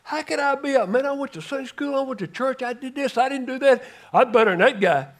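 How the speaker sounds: high, pleading whine of a man who thinks he earned it; protesting sinner. Retold or imitated. Preacher voices the sinner arguing with the umpire, whining. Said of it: "jump up like I did and say".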